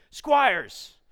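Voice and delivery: high, loud shouted summons; barking commanding officer. Retold or imitated. Commanding officer calls out his trainee, in a loud bark. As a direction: bellow the surname like an officer.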